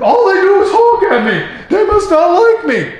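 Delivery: high-pitched mock complaint; oblivious driver. Voices an oblivious wrong-way driver.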